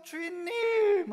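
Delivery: long, high, loud acted cry for help. Trapped sheep screaming for its master, acted desperate shout. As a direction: call desperately to your master, drawing it out. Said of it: "소리를 지르면서".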